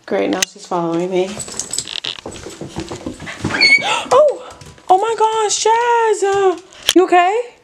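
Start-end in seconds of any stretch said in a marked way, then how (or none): 1.3-4.1 sharp alarmed gasp. Real, not acted. Dog suddenly hurt or startled. 4.1-7.5 worried high pitch. Real, not acted. Worried about her dog.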